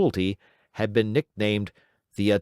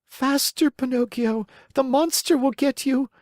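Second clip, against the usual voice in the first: much higher, pleading little goat voice; bleating goat; 14 semitones higher than usual. Acted. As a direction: beg him to hurry like a bleating goat. Goat urges Pinocchio.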